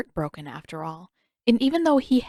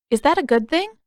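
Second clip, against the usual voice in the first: high, bright curious question; innocent question; 8 semitones higher, 5 dB louder than usual. Acted. Aang asks the fortune teller, high and innocent. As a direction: ask with wide-eyed innocence.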